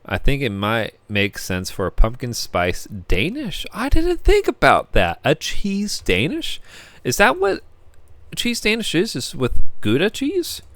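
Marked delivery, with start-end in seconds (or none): 3.7-10.6 high, excited realization; surprised. Real, not acted. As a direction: squeal it out like a discovery. Sudden realization about cheese Danish.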